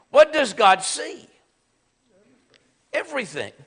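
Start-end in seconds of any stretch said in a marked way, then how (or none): none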